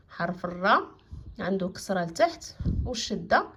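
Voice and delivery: ordinary delivery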